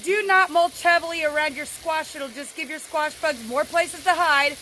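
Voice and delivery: ordinary talk, nothing marked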